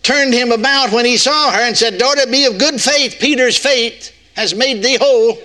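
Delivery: lofty scripture voice with a deliberate slip; mock misreading of scripture. Preacher jokingly misreads scripture to the congregation, lofty. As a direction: read it grandly and slip in a wrong name. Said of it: "Oh, no, I missed that".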